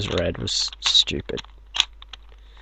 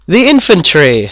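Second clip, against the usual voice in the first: loud; 7 semitones higher, 8 dB louder than usual. Acted. Loud punchline.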